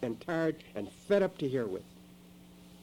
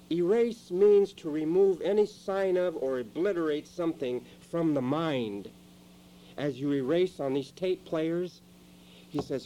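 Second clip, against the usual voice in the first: normal talking voice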